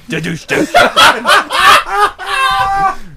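high-pitched laugh; high laughter. Real, not acted. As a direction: crack up at your own joke. Speaker laughing at own joke.

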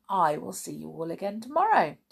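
usual speaking voice

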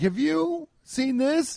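high, drawn-out disbelief; incredulous question. Retold or imitated. Patient asking the test lady about his booger, incredulous.

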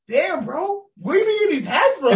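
high, loud mock amazement with a laugh; laughing, high imitation of a hyped friend. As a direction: play the friend gassing up his hats. Retelling friend's hyped question.

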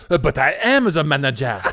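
high, loud laughing French voice; mock French accent. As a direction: ham up the snooty manager. Mimicking French manager.

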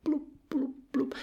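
tiny high, soft bloops; imitated bubble blips. Goldie waiting for Kat's reply, small high bubbles. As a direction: make little fish bubbles with your mouth.

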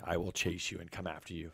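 usual speaking voice

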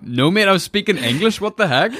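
high-pitched incredulous protest; mock indignation. Retelling a Skype call to someone baffled, mock indignant.

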